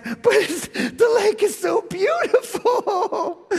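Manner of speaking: very high, slow pleading whine with a laugh; high whiny excuse. Retold or imitated. Caught by Mark, he whines about the lake in a very high voice.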